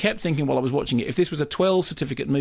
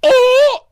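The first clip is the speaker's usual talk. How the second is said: squeal; 14 dB louder than usual. Real, not acted.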